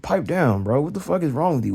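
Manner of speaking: normal talking voice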